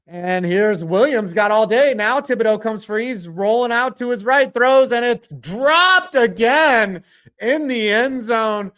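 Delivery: rising announcer building on the rollout; play-by-play call. Acted. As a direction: call the throw like a broadcaster.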